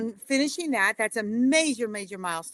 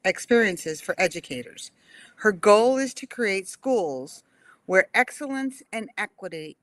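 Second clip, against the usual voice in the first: normal talking voice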